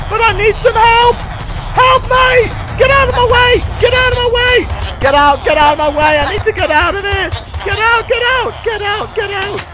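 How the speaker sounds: high, frantic yelling for a way out; panicked shouting. Pretend. Player needs help and must get out, panicked shouting. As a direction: shriek it urgently, desperate to get through. Panicked escape from a crowd, pleading.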